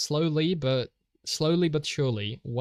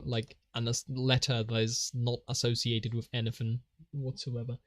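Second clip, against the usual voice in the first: usual speaking voice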